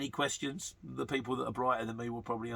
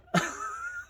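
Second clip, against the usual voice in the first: laugh. Real, not acted. Laughs at his own money joke.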